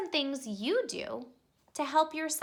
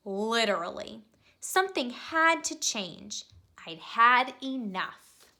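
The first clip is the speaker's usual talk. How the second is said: put-on lively storybook narration for kids; expressive read-aloud for young listeners; reading to children; 5 semitones lower than usual. A narrator's reading. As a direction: read it like a bedtime picture book. Reads picture book to children.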